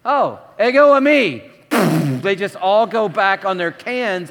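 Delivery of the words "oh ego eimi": bold answer. Pretend. Jesus replies in acted scene.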